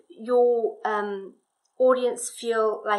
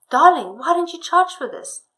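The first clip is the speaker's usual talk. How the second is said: husband's line higher and louder, in own voice; change of tone; 6 semitones higher, 9 dB louder than usual. Shown as a demonstration. Husband urging wife to charge for it, change of tone. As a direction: lift pitch and volume for the husband's question. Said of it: "with the change in, in tone".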